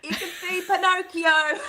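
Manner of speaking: high-pitched, laughing jibe. Real, not acted. Co-host joking about the lie, laughing. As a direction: tease it out, breaking into laughter.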